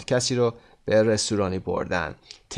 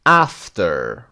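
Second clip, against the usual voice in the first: after with AF hit, falling away; stress on first syllable; 5 semitones lower, 4 dB louder than usual. Shown as a demonstration. Teacher working through the exercise, first-syllable stress on after. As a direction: punch the start of after. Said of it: "قسمت اول".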